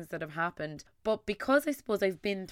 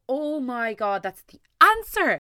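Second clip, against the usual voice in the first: higher and louder on the answer, slowed; excited realization; 5 semitones higher, 8 dB louder, slower than usual. Retold or imitated. Listener finding the answer from a guest, excited. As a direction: burst out with the discovery. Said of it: "make you go".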